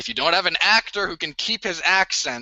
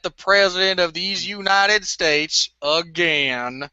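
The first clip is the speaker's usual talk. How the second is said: Forrest Gump; 2 semitones lower than usual. Acted. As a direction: draw out the last words like a simple country man. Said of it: "Forrest Gump".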